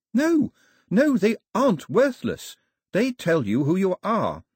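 high-pitched, passionate objection; Daphne, protesting. Acted. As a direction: burst out in urgent protest. Narrator voices Daphne protesting.

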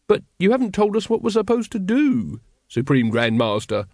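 a touch of petulant whining; whined. A narrator's reading. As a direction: complain like a put-upon junior. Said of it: "whined Brother Watchtower".

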